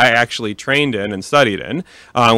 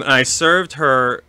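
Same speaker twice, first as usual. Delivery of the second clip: normal talking voice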